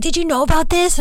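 mock excited husband, pitched high; imitating husband. Husband on the phone asking her, high mock voice. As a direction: play Chad breathless on the phone.

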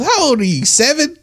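high, scornful questions; incredulous taunt; mocking. Real, not acted. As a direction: ridicule the ask. Mocking the two-dollar request.

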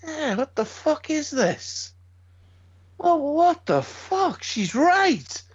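realising his wife was right, in slow high disbelief; stunned inner voice. Retold or imitated. Telling a mate his reaction, slow high-pitched disbelief. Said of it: "and then I was thinking".

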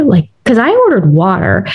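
normal talking voice

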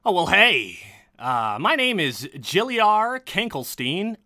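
high, loud, swooping character voice; gnome's greeting. Acted. Gnome introducing herself, high animated voice. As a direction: greet a stranger in an animated put-on voice. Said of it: "she goes".

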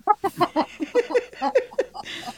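high bright burst of laughter; laughing. Real, not acted. Laughs at the sign joke.

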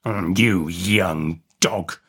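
very slow, relishing convict; convict licking his lips. A narrator's reading. Hungry man eyeing the boy, slow and relishing. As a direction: draw it out as if eyeing a meal. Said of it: "licking his lips".

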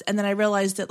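usual speaking voice

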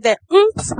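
"oomph" said loud and high; oomph emphasized. Shown as a demonstration. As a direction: punch out "oomph" with a push. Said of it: "I hope y'all can hear that".

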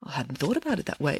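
soft, abashed confession; sheepish admission. A narrator's reading. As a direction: admit it softly. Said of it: "admitted the king".

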